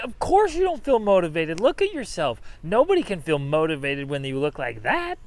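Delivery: high and loud, punching it out; energized retort. Real, not acted. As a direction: snap them out of it with energy.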